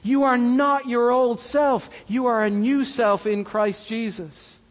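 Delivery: ordinary delivery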